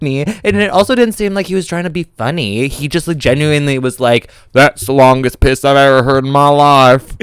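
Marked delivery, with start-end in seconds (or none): 4.5-7.2 put-on Southern accent. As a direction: play him as an earnest Southern good ol' boy. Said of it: "that was a character choice that I added".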